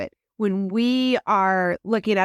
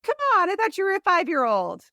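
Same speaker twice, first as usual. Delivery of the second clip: mock teasing; 8 semitones higher than usual. Parent teasing crying child.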